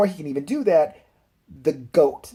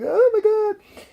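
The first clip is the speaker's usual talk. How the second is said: very high, slow, louder imitated wail; mock distraught girl; 6 dB louder, much slower than usual. Girl sitting in the shed after a murder, high mock wail. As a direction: wail it slowly as a stunned girl.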